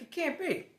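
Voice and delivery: high, loud, flat refusal to believe; disbelief. Retold or imitated. Alone on the sidewalk, high incredulous denial. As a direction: deny it outright.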